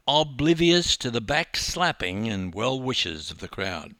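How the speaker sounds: ordinary delivery, nothing marked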